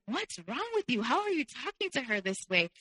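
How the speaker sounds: high-pitched, appalled questioning; acted indignant scolding. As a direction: imitate a friend calling someone out. Friend scolds the boyfriend, acted out.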